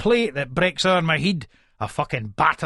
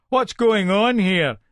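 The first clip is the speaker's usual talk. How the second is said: arriving policeman; 4 semitones higher, slower than usual. Acted.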